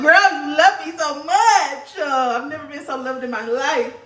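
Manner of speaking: high, slow, gushing with laughter; delighted squeal. Real, not acted. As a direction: gush over the gift in a high voice. Delighted by friend's gift.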